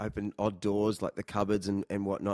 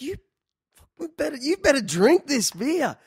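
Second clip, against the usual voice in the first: high, loud exasperated demand; mock angry insisting; 6 dB louder than usual. Narrator to his guitarist at the last gig, mock angry demand. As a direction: push the beer on him hard, half joking. Arguing with guitarist over beer.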